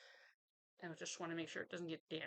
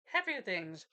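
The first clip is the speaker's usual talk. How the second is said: brightening up; 2 semitones higher, 8 dB louder, slower than usual. Real, not acted. Lifting mood after sad story.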